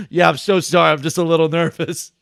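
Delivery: acted-out embarrassed outburst; flustered apology. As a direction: blurt out an over-loud sorry, mimicking your nervous self.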